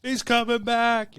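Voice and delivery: high, slow drawn-out hype call; hyped cheer. Pretend. Host hyping the show intro in a high call. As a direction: pump the crowd up, drawn out and high.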